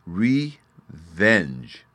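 "Revenge" drawn out slowly and loud. Shown as a demonstration. Narrator teaching the fairy boy the word, very slow. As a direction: spell out "Revenge" syllable by syllable. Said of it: "saying the word very slowly".

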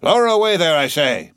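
loud, high roar of command; thundering voice. A narrator's reading. Captain repeats the order, thundering. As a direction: roar it so the men jump. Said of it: "the thunder of his voice".

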